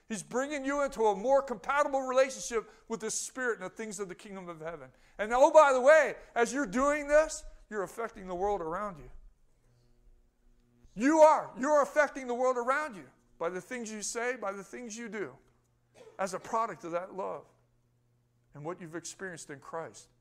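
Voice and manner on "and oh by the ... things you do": louder, higher, driving preacher voice; full-force preaching. Acted. Preacher lifting the congregation, fervent and loud. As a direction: preach it out, building fire to the church.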